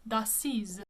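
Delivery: d'Assise with a buzzing Z, dah-SEEZ; French Z sound. Shown as a demonstration. Coach repeating the name for singers, French pronunciation. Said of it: "we say d'Assise with the Z".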